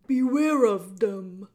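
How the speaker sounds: loud, slow booming warning; old jailer warning Meg about rats; shouted warning. Acted. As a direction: boom the warning out. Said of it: "shouted Gregory".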